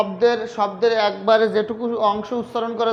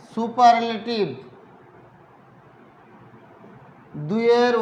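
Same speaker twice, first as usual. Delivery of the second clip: slow, stretched words for writing along; slow dictation; slower than usual. Real, not acted. Teacher dictating the superlative rule to students, slow dictation. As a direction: dictate phrase by phrase with long pauses.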